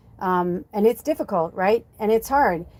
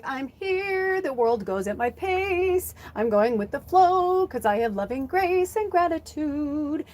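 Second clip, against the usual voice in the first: calm, easy-going announcement; chill version; 6 semitones higher than usual. Shown as a demonstration. Showing viewers the chill way to say I'm here. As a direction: say it relaxed and gracious. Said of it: "see the difference?".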